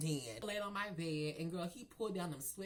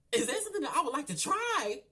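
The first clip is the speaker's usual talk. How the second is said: loud, high, laughing disbelief; how could you even ask, laughing and loud; incredulous laughing echo; 10 semitones higher, 10 dB louder, faster than usual. Retold or imitated. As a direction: throw the question back, laughing that he even asked. Incredulous echo of his question.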